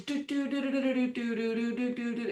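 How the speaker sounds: soft, high rewind "do-do-do"; rapid little doodling run, easing down in pitch; rewinding noise. Shown as a demonstration. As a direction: imitate a tape whizzing backwards. Rewind it backwards.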